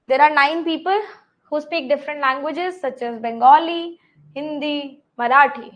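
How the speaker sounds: reading the puzzle out slowly, word by word; slow question reading. Acted. Teacher reads the puzzle out slowly for her students. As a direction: dictate it slowly to the class.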